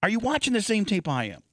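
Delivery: high-pitched, exasperated challenge; disbelieving, pointed question; incredulous. Real, not acted. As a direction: raise the pitch in open disbelief. Challenging the quoted article's claim.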